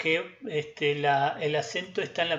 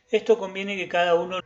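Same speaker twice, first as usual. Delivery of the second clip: ordinary talk, nothing marked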